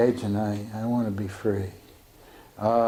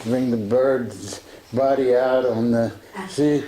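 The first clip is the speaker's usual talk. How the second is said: ordinary talk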